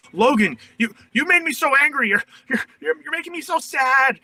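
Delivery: high, far-off wailing blame at Logan; triggered whiny outburst. Retold or imitated. Triggered person blaming Logan, high whining complaint. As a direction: whine it like a hurt child lashing out. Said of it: "You're like".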